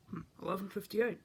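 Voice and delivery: normal talking voice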